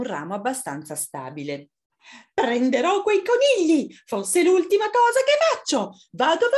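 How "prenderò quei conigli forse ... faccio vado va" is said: very high, loud little fox; small fox launching into action; little fox, determined. Acted. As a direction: squeak it out with bold resolve.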